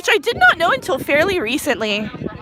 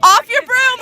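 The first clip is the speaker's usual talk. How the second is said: sharp shout; shouted call; 10 semitones higher, 5 dB louder than usual. Real, not acted. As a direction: yell it at the player. Referee call to player.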